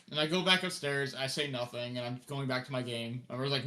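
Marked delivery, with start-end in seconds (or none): none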